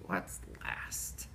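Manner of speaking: whispered suspense; hushed guessing. Pretend. Teacher feeling for the last hidden letter, whispered. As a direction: drop to a voiceless hush.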